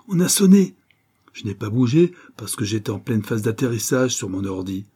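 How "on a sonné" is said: high, loud quoted call. A narrator's reading. Maïtena calling that the doorbell rang, as Fred retells it, calling out. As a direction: call it out to someone in another room. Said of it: "Maïtena a crié".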